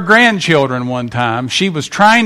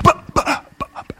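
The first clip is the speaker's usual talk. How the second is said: much higher, fading 'but, but'; child's protests; 10 semitones higher, 5 dB quieter than usual. Retold or imitated. Child talking back to a parent, higher and fading. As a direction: mimic a kid butting in. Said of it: "had your kids do that".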